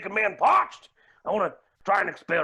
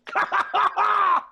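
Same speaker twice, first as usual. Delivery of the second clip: high burst of laughter; 12 semitones higher than usual. Real, not acted. Laughs at own joke.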